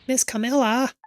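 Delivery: high, excited call of "Miss Camilla"; eager little dog. Acted. Dog character calls owner.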